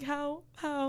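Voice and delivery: high, soft disbelieving "how"; incredulous questioning. Retold or imitated. Friend challenging people who shrugged off the video, incredulous.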